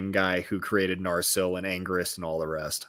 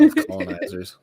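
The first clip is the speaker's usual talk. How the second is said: high, laughing repeat; amused squeal of a repeat; laughing echo; 6 dB louder than usual. Real, not acted. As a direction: laugh it back, much higher. Echoing the joke, amused.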